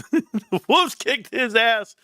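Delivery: slow, high, laughing through the words; real laughter breaking through the punchline. Real, not acted. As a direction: crack up as you repeat it. Repeating punchline while laughing.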